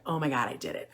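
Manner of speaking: quiet amazed parent; stunned murmur. Retold or imitated. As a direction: murmur it as if you can't believe it. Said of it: "you're gonna be like".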